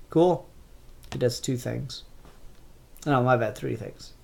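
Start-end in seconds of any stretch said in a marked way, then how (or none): none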